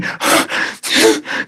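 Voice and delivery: tender sigh after God's words; soft sigh. Acted. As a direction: let out a quiet sigh. After voicing God.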